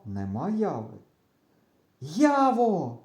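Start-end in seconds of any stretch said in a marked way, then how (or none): 2.0-3.0 high, drawn-out searching call. A narrator's reading. Boy calling his friend he cannot find, calling out. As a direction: call the name into the distance. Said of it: "гукаю нарешті".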